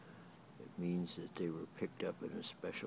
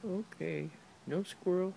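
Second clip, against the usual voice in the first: high dog talk; 12 semitones higher than usual. Real, not acted. Cooing to the dog.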